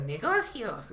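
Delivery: high, squawked bird voice; squawking parrot. Retold or imitated. Kenku bird-man squawking at the party. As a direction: shout it like a parrot. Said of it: "y dice".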